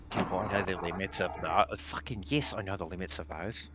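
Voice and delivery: understated indignation, kept low and soft; offended retort, muttered back quietly; indignant. Acted. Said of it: "like, indignant".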